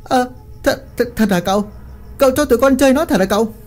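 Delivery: repeating and stumbling, then rushing fast and high; stammer, then gabble the question; stammering disbelief. A narrator's reading. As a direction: henchman stammering to his master. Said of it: "lắp ba lắp bắp hỏi lại".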